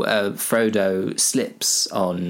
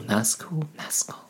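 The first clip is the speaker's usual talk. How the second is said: fading echo; 7 semitones higher, 6 dB quieter than usual. Shown as a demonstration. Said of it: "I was about to say the Razgul".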